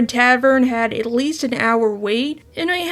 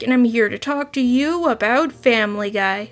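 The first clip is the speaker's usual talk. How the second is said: ordinary talk, nothing marked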